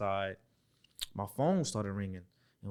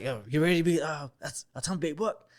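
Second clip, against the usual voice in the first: a quick, lightly voiced coach's call, a touch higher; imitating Bruce Arians; 5 semitones higher, 4 dB louder than usual. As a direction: toss it off fast like an excited head coach. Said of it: "and he talked fast".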